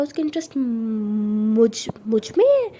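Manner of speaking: she can't believe what she heard; stunned disbelief. Acted. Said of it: "सना काफ़ी ज़्यादा shocked हो जाती है".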